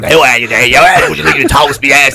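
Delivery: a bit of bread being sociable; chatty talking bread. Acted. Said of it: "[name]'s bread going".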